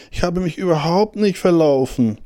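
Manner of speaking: high-pitched, emphatic denial; insistent little hare. A narrator's reading. Lost hare denying that he is lost, high insistence.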